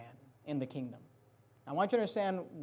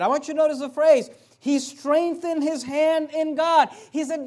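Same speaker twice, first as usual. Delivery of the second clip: impassioned preaching; 7 semitones higher, 9 dB louder than usual. Acted. Preacher acting Jonathan cheering David on. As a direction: lift the whole room.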